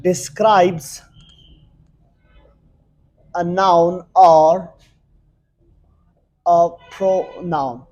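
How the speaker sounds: very slow, word by word, with long pauses; spaced-out dictation pace. Shown as a demonstration. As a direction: dictate it slowly while writing. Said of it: "Let me write the definition".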